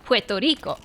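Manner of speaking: Puerto Rico the Spanish way; "Puerto Rico" as pweh-toh REE-koh, rolled into Spanish sounds; Spanish pronunciation. Retold or imitated. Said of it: "they would say".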